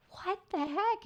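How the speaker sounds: high-pitched retold bafflement; baffled disbelief. Traveler retelling her reaction to a full scan, baffled disbelief. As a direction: act out a startled, incredulous question.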